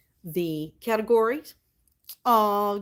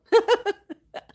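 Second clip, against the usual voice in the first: short high laugh; a quick amused burst; 7 dB louder than usual. Real, not acted. Streamer laughing after telling chat not to be a weirdo.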